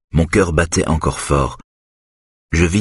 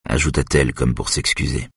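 usual speaking voice

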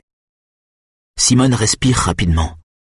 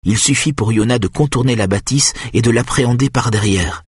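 ordinary delivery

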